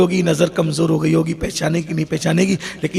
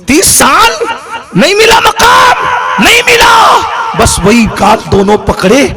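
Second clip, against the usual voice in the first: preaching at full force; 11 dB louder than usual. Acted. Preacher crying out the mother's prayer to the gathering, full force. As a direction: roar each line out to the heavens. Sermon climax, shouted and drawn out.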